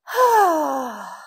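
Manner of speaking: big loud sighing breath out; heavy releasing sigh; forceful exhale. Shown as a demonstration. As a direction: let it all go on a long, loud "ah". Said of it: "je souffle fort".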